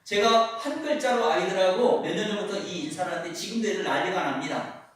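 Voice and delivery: usual speaking voice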